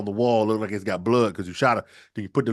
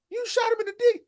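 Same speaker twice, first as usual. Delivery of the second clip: much higher incredulous mom voice; shocked mom. Acted. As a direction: squeak it out in disbelief. Mom reacts to shooting.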